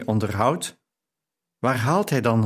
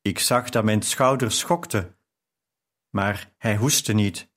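ordinary delivery

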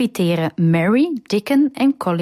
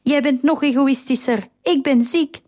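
put-on higher-pitched, indignant boy's retort; mild narrated yell; 6 semitones higher than usual. A narrator's reading. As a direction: protest in a raised pitch without shouting. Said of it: "schreeuwt Colin". (verontwaardigd, een lichte voorgelezen schreeuw)

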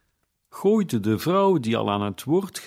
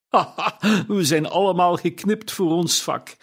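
higher, laughing through the words; chuckling old man; 7 semitones higher than usual. Acted. Old Joe laughing at his joke to his guests, chuckling.

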